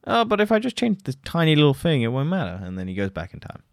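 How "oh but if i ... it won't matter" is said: much higher, wheedling parody voice; mock naive Barry. Parodying Barry's reasoning about time travel, high mock voice. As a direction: lampoon his innocent excuse. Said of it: "And then Barry's like".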